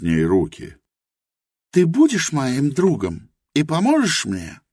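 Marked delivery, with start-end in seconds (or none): 1.7-4.6 Helena's voice. A narrator's reading. Helena admits her ignorance to Rosa, high and earnest. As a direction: confess humbly in Helena's high voice.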